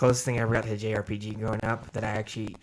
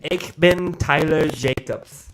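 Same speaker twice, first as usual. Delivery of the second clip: slow, loud movie villain German; hammy German villain line; movie villain german accent; 4 semitones higher, 7 dB louder, much slower than usual. Pretend. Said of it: "I can't do a German accent".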